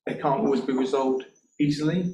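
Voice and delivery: usual speaking voice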